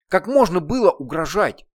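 loud stress on можно, falling; angry reproach. Acted. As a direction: press можно again in anger. Heroine berates demon.

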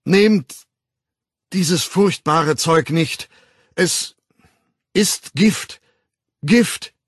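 halting, loud, high-pitched plea; struggling for words. A narrator's reading. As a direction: urge them in broken phrases, groping for words. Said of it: "es fiel ihm sehr schwer, die rechten Worte zu finden". (stockend, laut beschwörend)

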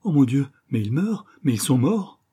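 loud, high cry of horror; horrified realisation. Acted. Girl discovers the guests dying.

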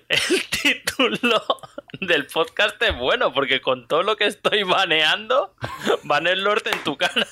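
high, giggling delivery; laughing through it. Real, not acted. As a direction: crack up at your own pun. Laughing at own pun. (riéndose de su propio chiste)

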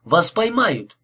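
mild defiant exclamation; exclaiming. A narrator's reading. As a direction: warn him with some urgency. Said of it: "воскликнул Гарри".

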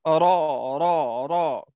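"راء" drawn out with a heavy r; teacher's demo of emphatic "راء"; heavy raa. Shown as a demonstration. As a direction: repeat "راء" low, sound rising to the palate. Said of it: "هناك تصاعد للصوت إلى".

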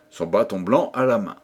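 ordinary delivery, nothing marked

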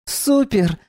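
high delighted squeal. Acted. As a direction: pitch it up with joy. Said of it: "обрадовалась Доминика".

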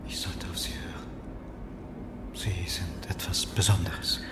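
tonlos, tief, langsam; unterwürfiges Flüstern. Acted. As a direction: schmierig umschmeicheln. Müller schmeichelt flüsternd. (Müller flüstert Marlow ergeben Lob zu)

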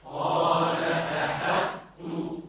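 distant group chant of قال أحطت; faint soft repeat; class echoing from afar. Real, not acted. Students repeating the corrected قال أحطت. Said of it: "رققوا الحاء".

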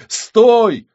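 very high, loud desperate shout. Acted. Witch orders the reflection to halt, desperately. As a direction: shout it in despair. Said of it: "в голосе женщины слышалось отчаяние".

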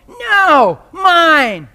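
put-on high, loud stubborn toddler. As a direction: shout it like a two-year-old grabbing a toy. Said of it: "teach two-year-olds to go".

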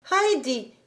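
a lightly voiced little girl, clear; child answering the governess, clear and plain; clear, distinct child. A narrator's reading. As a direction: say your name clearly and simply. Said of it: "de sa voix claire et distincte".

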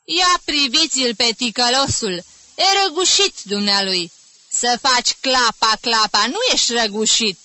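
loud teasing reprimand; humorous scolding. A narrator's reading. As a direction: show up the culprit before the class. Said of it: "îl mustră cu umor".

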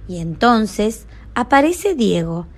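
ordinary delivery, nothing marked

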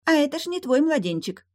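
high, probing mermaid voice; sly mermaid. Acted. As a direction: probe slyly, pitched high. Mermaid eyes the baby.

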